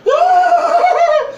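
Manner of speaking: high, loud startled shriek with a laugh; startled scream. Retold or imitated. Tough biker girl startled by his tongue, shrieking high and loud. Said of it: "she went".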